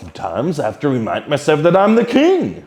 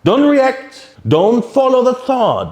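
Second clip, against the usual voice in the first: higher and louder, firm, follow drawn out; urgent warning; 12 semitones higher, 5 dB louder, slower than usual. Shown as a demonstration. Teacher warning meditators not to follow thoughts, raised and urgent. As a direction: cut off the habit of chasing thoughts.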